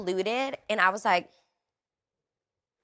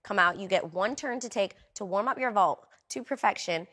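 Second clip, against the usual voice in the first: ordinary talk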